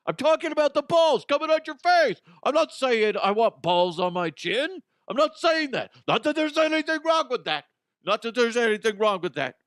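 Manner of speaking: high, loud hammed-up indignant voice; mock outraged quote. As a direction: ham up a shocked snippet voice. Mocking a misquoted soundbite.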